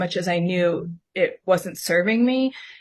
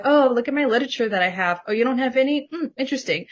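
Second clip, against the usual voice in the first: higher, falsely helpful self-parody; mock manipulative; 5 semitones higher, faster than usual. Woman mimicking how she showed off her literature, mock manipulative. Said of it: "how manipulative that was".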